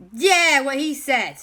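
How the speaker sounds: very high, loud backing-up yell; defiant shout. Acted. Jack backing up his friends against the mean guys, defiant shout. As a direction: pipe up loudly behind your friend. Jack eagerly backs up Owen.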